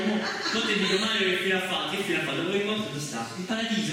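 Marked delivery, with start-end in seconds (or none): none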